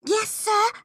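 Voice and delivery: small, high-pitched pupil's reply; meek girl giving in; obedient schoolgirl. Acted. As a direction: answer the teacher in a high little voice. Pupil reluctantly obeys stern teacher.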